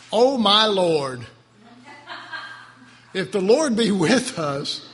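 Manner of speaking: loud, slow aggrieved complaint; rough-state Gideon. Shown as a demonstration. Preacher rereads Gideon in a rough state, loudly aggrieved. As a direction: push it out loud and drawn out, as a grievance. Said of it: "Gideon's state's pretty rough".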